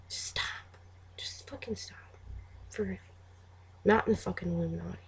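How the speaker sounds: very soft, drained plea; weary exasperation. Acted. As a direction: sigh it out, barely voiced. Acts out exasperated reply to commenters.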